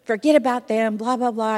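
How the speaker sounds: loud and high, fired up on his wife's behalf; husband loudly defending his ditched wife, upset; upset husband's outburst. Retold or imitated. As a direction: belt it out like an indignant husband. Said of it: "he was all upset".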